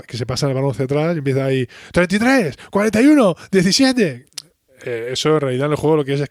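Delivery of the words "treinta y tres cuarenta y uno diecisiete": high, loud play call like a quarterback. Retold or imitated. Quarterback barking play numbers to his team, high and loud. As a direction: shout the numbers to the huddle, pitched up.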